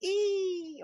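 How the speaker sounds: very high shrieking monster cry; monster screech. Retold or imitated. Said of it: "sound like people screeching".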